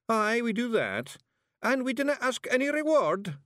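high, emphatic Scots declaration; stout. A narrator's reading. Tiny warrior assures witch of his service, stoutly. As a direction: declare it staunchly in broad Scots. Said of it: "said Rob Anybody stoutly".

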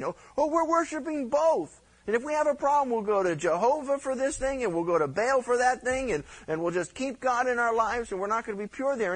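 high, breezy mock voice of a two-faced believer; mock smug worshipper. Preacher parodying people worshipping Jehovah and Baal: high breezy mockery. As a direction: parody someone serving two gods.

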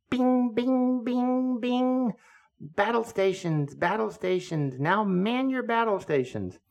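high squawky bird voice; parrot alarm call. Retold or imitated. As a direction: squawk like a parakeet sounding alarm. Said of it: "then he would say".

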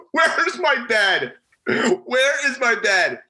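incredulous whine. Retold or imitated. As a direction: stretch it out like you can't believe it's gone. Pierce panics over missing bed.

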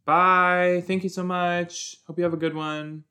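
high, bright customer-service goodbye; sing-song polite farewell; chirpy sendoff. Real, not acted. Cheerfully thanking someone leaving.

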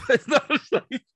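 cracking up retelling; laughing. Real, not acted. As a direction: laugh through the warning. Laughing while quoting himself.

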